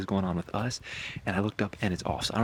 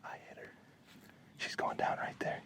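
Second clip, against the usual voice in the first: whispering; 12 dB quieter than usual. Real, not acted. Whispering near deer before second shot.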